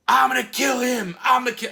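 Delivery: high, loud, raging threat; enraged man shouting he'll kill him; furious shout. Retold or imitated. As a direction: scream it across the bar. The guy he hit threatens.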